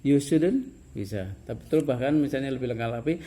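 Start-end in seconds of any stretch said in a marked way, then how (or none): 0.0-0.7 "You student" higher and louder, rising on "student"; question intonation. Shown as a demonstration. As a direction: ask "You student" with tone alone. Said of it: "hanya nadanya nada bertanya".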